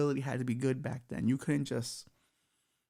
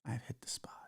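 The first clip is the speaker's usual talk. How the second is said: sultry cyber demo; 4 dB quieter than usual. Shown as a demonstration. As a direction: breathe the last words as an intimate whisper. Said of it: "That's how you do it".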